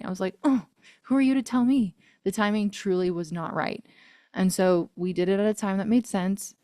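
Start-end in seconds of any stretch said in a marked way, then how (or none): 0.4-1.9 defiant. Retold or imitated. Defiant retort to producers.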